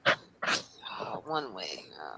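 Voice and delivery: whispering. Real, not acted. Remembers song fondly.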